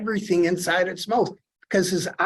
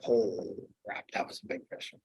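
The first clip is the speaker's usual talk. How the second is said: breathy whisper, deflated; hushed, gutted whisper; whispered dismay; 7 semitones lower, 10 dB quieter than usual. Retold or imitated. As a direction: whisper it like the fish just got away. Retold dismay at lost fish.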